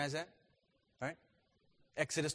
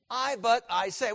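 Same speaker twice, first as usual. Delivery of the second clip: "I, but I say" with each word hit hard; emphatic literal rendering; 9 semitones higher, 9 dB louder, slower than usual. Shown as a demonstration. Teacher showing the Greek word order, emphatic. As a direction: punch out "I, but I say", higher and louder. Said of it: "We don't talk like that".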